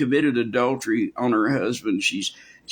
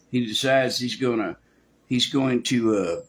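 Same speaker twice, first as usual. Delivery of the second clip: usual speaking voice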